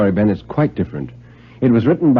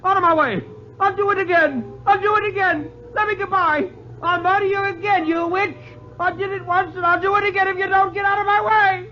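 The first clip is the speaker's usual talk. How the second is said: high, frantic screaming confession; a cornered murderer losing all control; hysterical shouting. Acted. Bedford confesses to the ghost woman, shrieking hysterically high. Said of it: "and he shouted".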